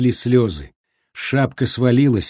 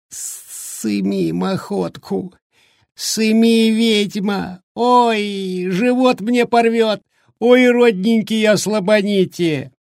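high, whimpering like a puppy; thin wailing voice; 10 semitones higher, slower than usual. Acted. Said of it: "тоненьким голосом заголосил, заскулил пощенячьи".

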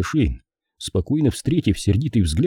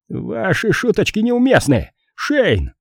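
much higher-pitched protest; indignant exclamation; 12 semitones higher, slower than usual. A narrator's reading. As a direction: exclaim it high, without shouting. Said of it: "воскликнул Пейнтер". (возмущённый возглас высоким голосом)

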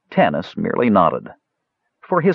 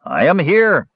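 higher, gruffly called reply; old fisherman calling from a window; 10 semitones higher, 4 dB louder, slower than usual. A narrator's reading. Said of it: "the old fisherman called gruffly".

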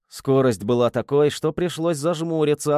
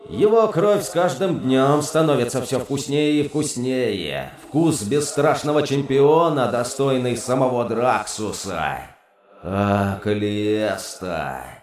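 savouring demon voice; demonic whisper. Acted. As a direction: relish each word like wine.

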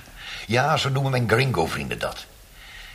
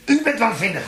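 much higher and louder, laughing madly; loud, laughing, crazed tirade; manic ranting shout; 10 semitones higher, 3 dB louder than usual. Acted. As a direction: throw the word back at him, unhinged. Interrogator turns the accusation back.

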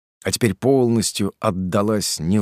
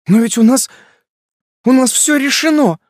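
high, loud tearful protest; cling to what was decided, voice climbing; desperate protest; 9 dB louder than usual. Acted. Bride protests the breakup.

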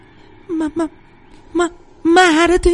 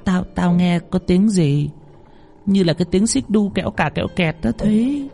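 put-on uneasy old cook; 8 semitones higher, 4 dB quieter than usual. A narrator's reading. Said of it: "Bà bếp nghĩ chắc là mình nghe lầm".

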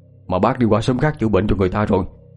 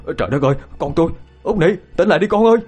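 horrified parent's plea, climbing much higher; trembling cry; 7 semitones higher, much faster than usual. Acted. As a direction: cry out in panic, building to the end. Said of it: "giọng run rẩy".

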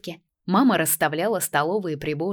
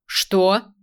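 loud short bark; 2 semitones higher, 5 dB louder than usual. A narrator's reading. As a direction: bark the question. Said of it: "гаркнул дед".

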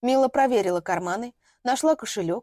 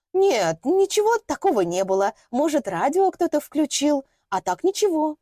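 fawning; 6 semitones higher, 4 dB louder than usual. Acted. Dinora lying to landlady.

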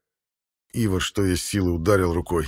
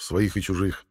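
usual speaking voice

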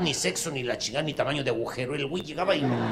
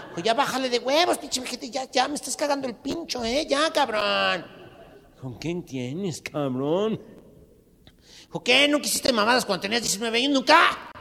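punk yelling; 9 semitones higher than usual. Acted. Punk shouting at old man.

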